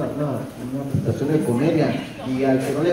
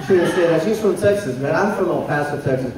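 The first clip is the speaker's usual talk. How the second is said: ordinary talk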